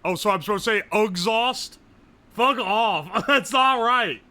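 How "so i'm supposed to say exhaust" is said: ordinary talk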